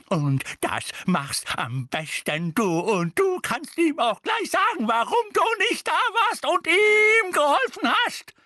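slow start building to a high shriek; furious screaming. A narrator's reading. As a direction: build from emphatic scolding to a full shriek. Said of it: "schrie Misses Figg".

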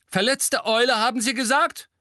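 high, loud no-nonsense voice; brisk gruff teacher. A narrator's reading. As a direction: bark the question like a gruff vet. (resolute Lehrerin, laut)

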